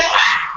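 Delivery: high scream heard through a door; high muffled scream; scream behind door. Retold or imitated. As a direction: let out a shriek. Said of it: "you hear".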